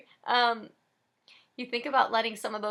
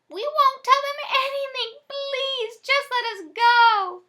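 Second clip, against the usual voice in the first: much higher, pleading whine; whining old lady; 11 dB louder, slower than usual. Acted. Old lady whines to the robbers to let her go. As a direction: beg the robbers in a thin old voice. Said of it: "One of the old ladies whines".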